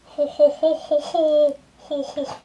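deliberate ho ho laugh; forced laugh without smiling. Shown as a demonstration. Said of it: "try to laugh without smiling".

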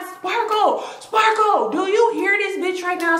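high, laughing call of disbelief; calling out to a friend. Retold or imitated. To Sparkle on speakerphone about her friend, laughing high call. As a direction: yell her name, half laughing, to get her on your side.

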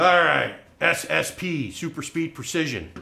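calling out. Real, not acted. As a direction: grab the crowd's attention with a loud call. Calling the crowd to attention.

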